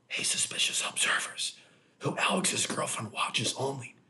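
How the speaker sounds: breathy mock whisper of the rival's pitch. Mocking the rival's show in a mock whisper. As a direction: hush it like a sneaky mock intro.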